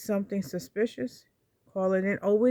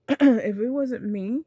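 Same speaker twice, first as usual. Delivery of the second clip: clears throat, then says it louder; louder, insistent restart; firmer restart; 4 semitones higher, 6 dB louder, slower than usual. Real, not acted. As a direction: clear the throat and come back firmly.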